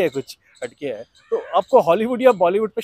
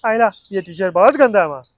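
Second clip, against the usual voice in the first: put-on higher, louder childlike Rohit voice; Rohit from Koi Mil Gaya; 3 semitones higher, 7 dB louder than usual. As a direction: play the innocent boy Rohit, whiny and loud. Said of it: "अब आई कोई मिल गया".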